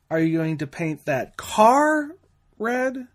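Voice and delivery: red said high and apart after a pause; stress on red. Shown as a demonstration. Instructor demonstrating emphasis on red, rising. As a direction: question the color red. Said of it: "or emphasize one word".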